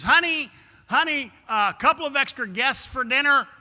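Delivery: high, loud call into the house; breezy high call announcing guests; calling to wife. Retold or imitated. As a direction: sing out to the wife in the kitchen. Husband calls to wife.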